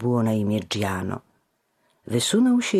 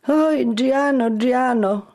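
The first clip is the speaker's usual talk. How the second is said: high, slow tearful whine; whining; 13 semitones higher, 5 dB louder, slower than usual. A narrator's reading. Fat friend whines at reckless Giano. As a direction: plead with a whimper. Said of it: "mówił płaczliwie".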